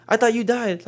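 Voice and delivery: a high squeaky quote; high-pitched movie quote. Retold or imitated. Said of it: "it's like".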